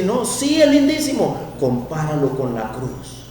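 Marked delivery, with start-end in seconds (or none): 0.0-1.4 high mock-admiring insistence; mock gushing. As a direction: lightly imitate an eager defender. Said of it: "dice Stacy".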